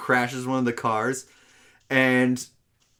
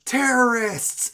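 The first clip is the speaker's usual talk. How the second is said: startled cop shout; 12 semitones higher, slower than usual. Retold or imitated. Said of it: "This cop sees them and just goes".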